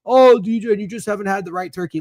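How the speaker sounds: high-pitched mocking quote of a turkey defender; mock objector. Voicing people who disagree.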